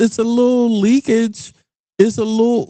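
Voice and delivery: lightly voiced high wrestler growl; a hint of a hammed-up wrestling promo; Macho Man Randy Savage. Comedian riffing on political leakage, light wrestler impression. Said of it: "I'm doing my Macho Man Randy Savage impression".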